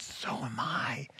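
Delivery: a secretive whisper, barely audible. Retold or imitated. Nervous churchgoer whispering his faith in a crowded post office. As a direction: whisper it as if afraid of being overheard. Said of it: "he lowered his voice to where I could barely hear him".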